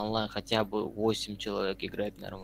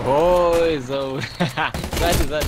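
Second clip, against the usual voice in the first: excited; 6 semitones higher, 12 dB louder, slower than usual. Real, not acted. Panicked warning to teammate.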